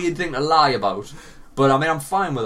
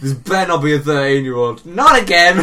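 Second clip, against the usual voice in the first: a laughing joke yelled much louder; laughing shout; 4 semitones higher, 8 dB louder than usual. Real, not acted. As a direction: bellow it through laughter.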